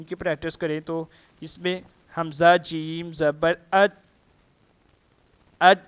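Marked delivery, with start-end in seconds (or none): 3.7-5.8 اج shut hard on the ج; stopped jeem. Shown as a demonstration. Teacher practising jeem with students, اج stopped. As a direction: stop اج dead on the jeem. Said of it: "اج کیا تو ہماری جو شدہ کی کوالٹی ہو گئی".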